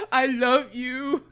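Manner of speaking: high, slow, hammed-up wail; mock crying. Imitating traumatized Billy in the spider web, slow mock-crying wail. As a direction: wail it drawn out, half laughing. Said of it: "Just crying".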